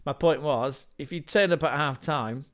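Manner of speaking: ordinary delivery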